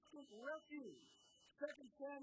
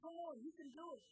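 higher, urgent preacher's push toward the close; rousing exhortation; 6 semitones higher than usual. Acted. Pastor urging listeners to go to God first, rising rousing call. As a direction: pull the room to its feet.

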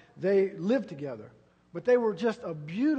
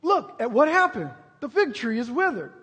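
preaching Jesus' reply; 8 semitones higher, 11 dB louder than usual. Acted. Preacher repeating Jesus' answer to the disciples, booming. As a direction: build to the command.

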